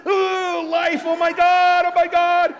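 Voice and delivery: high, loud, slow panicked wail; shrieking mock dread. Shown as a demonstration. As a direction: ham up the terror. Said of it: "Are you going like this?".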